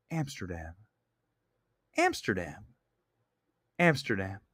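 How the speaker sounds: low, hushed, ominous whisper-talk; mocking the film's spooky camera address; deadpan movie ending. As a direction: murmur it into the camera, portentous. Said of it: "let me do a quick impersonation of".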